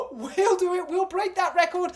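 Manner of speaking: high, loud, hammed-up gasp and boast; mocking voice. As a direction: send up a smug politician's big promise.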